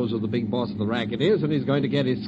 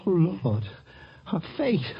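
horrified; 6 semitones higher than usual. Acted. Sees her injured face.

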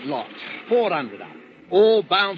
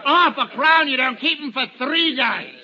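gloating laugh; 8 semitones higher than usual. Acted. Finch to the convicts in the hold, gloating laughter.

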